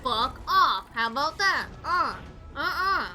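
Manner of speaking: loud angry snap; raging gamer. Real, not acted. Raging at boss fight.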